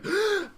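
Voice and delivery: sharp high gasp of shock; acted startled gasp; mock shocked gasp. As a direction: gasp like you just saw something awful. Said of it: "I did a very good shock face".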